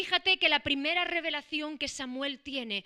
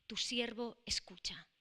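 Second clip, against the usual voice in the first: hushed whisper; 4 semitones lower, 9 dB quieter than usual. Acted. Samuel's reverent answer to God.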